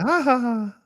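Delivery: high, sustained ha-ha call; mimicking a distant woman's high call. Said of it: "Just like that".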